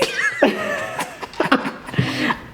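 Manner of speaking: unvoiced breathy high giggle; airy laugh. Real, not acted. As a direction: giggle under your breath. Laughs before eating.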